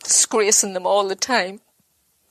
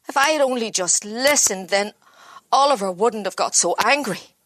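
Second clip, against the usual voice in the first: ordinary talk, nothing marked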